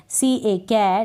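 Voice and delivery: ordinary talk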